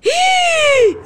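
sharp high gasp-scream of fright; a real jump-scare shriek; startled gasp. Real, not acted. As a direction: jolt with a shocked cry.

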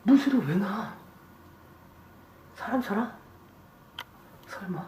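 hushed. Real, not acted. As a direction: keep it down, listening for a noise. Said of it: "조용히 해봐요".

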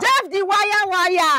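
high, loud, quick praise shout; excited hype. Acted. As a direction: fire the praise name off like a cheer. Friend hypes Jeff again.

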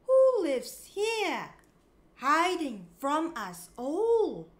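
plain reading voice

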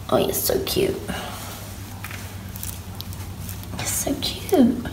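whispered cooing over a pet; whispering. Real, not acted. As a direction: breathe it out adoringly. Cooing over her rabbit.

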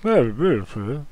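loud mumbling through food; mouths full. Acted. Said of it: "mouths full of food, and goes".

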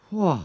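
high sigh of relief; relieved whew. A narrator's reading. Jonathan sinks back relieved, high whew. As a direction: let the tension out.

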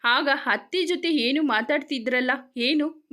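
ordinary delivery

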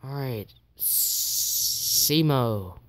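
slow whisper to himself; whispered psych-up. Real, not acted. Gamer whispering to himself before the attack, slow and hushed. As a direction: breathe it out slowly, bracing.

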